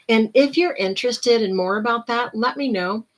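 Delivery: usual speaking voice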